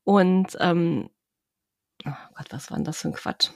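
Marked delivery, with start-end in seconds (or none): 2.0-3.5 fast embarrassed whisper. Real, not acted. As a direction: whisper it to yourself, quickly. Flüstert über Versprecher. (geflüstert)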